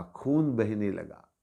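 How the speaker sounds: ordinary delivery, nothing marked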